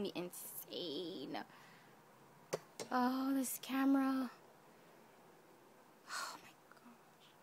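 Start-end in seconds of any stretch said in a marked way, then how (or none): 6.1-7.3 voiceless, very soft exclamation; awed whisper. Real, not acted. Overcome by how talented she is, awed whisper.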